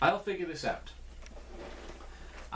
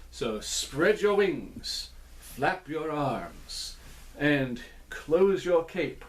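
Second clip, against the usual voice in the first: slow dance-step calling; much slower than usual. Shown as a demonstration. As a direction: call each dance move slowly. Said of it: "try out those steps".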